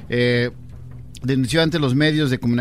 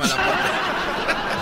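laughing; much slower than usual. Real, not acted. Cohost laughing at punchline.

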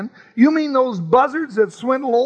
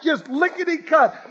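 ordinary delivery

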